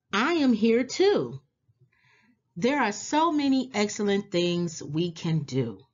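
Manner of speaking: usual speaking voice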